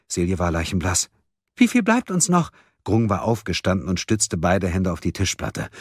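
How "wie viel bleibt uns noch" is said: high, urgent panicked question. A narrator's reading. Friends asking how long remains, panicked. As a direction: ask it in rising panic. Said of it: "Schlagartig breitete sich Panik aus".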